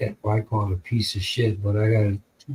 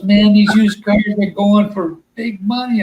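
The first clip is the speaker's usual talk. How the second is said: friend lecturing; 9 semitones higher, 5 dB louder than usual. Retold or imitated. Friend explains used car prices.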